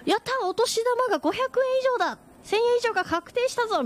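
put-on high, gleeful child's cheer; excited little kid. Small child thrilled by New Year money, high excited cheer. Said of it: "小さい子供だったとかだったら".

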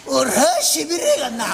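slow, pleased, laughing; drawn-out boast with a laugh; proud pupil. Acted. 弟子が得意がる. (笑いまじりに得意がる弟子)

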